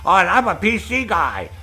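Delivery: smug high-voiced imitation; old guy impression. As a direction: imitate him bragging. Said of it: "he didn't tell me".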